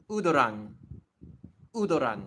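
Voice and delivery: loud, firm "udoran" with a do in the middle; Japanese-style pronunciation. Shown as a demonstration. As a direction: say "udoran" loudly as oo-do-ran. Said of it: "pronounced as to or do".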